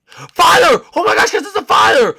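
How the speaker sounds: high, loud terrified shriek; panicked scream. Acted. Kid spotting a fire and screaming to the others.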